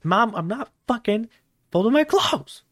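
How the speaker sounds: put-on high, rising bratty teen voice; a sulky teenager talking back to mom; whiny teen.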